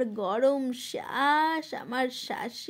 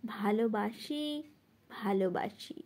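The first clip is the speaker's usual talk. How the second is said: tender reply; 5 semitones lower, 6 dB quieter than usual. Acted. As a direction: answer tenderly, sinking softer on the repeat. Tender answer in the poem's refrain. (কোমল, নিচু স্বরে)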